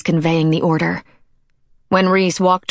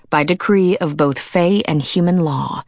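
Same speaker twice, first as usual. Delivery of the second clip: usual speaking voice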